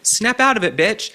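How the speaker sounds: calling out Michelle Obama; exasperated call. Real, not acted. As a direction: snap her out of it. Yelling at Michelle.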